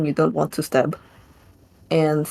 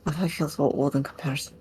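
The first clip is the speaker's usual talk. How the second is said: muttered to herself; 7 dB quieter than usual. Acted. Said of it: "mutters to herself".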